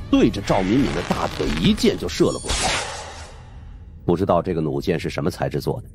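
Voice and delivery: plain reading voice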